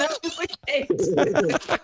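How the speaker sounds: slow, drawn-out mock grandeur; slow mock-posh repeat. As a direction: savour it like a title.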